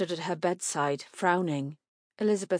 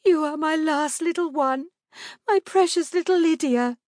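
high, loud, weepy cooing; tearful; 10 semitones higher, 7 dB louder than usual. A narrator's reading. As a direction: coo to the baby through tears. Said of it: "Still crying, she stroked".

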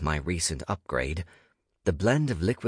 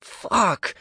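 sharp, high, loud curse; sudden high-pitched yell; cried out; 13 semitones higher, 4 dB louder than usual. A narrator's reading. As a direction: cry it out in agony. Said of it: "I cried".

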